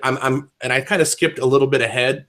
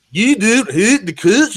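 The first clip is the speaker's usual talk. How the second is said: high, loud, over-enunciated mock voice; Matt Bell; 9 semitones higher, 5 dB louder, slower than usual. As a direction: mimic an annoying, over-pronounced cadence. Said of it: "he speaks so ridiculously slow".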